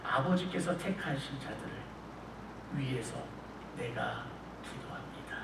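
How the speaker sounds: soft, hushed prayer fading to a whisper; Jesus praying. Retold or imitated. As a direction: pray it quietly, dropping to a whisper at the end. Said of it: "하나님께 그렇게 이야기하시는". (아버지께 속삭이며 기도하는 예수님, 조용하게)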